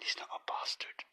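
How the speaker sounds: hushed, unvoiced take-back; whispered aside. Podcaster taking back his insult, whispering. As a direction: whisper it as a private correction.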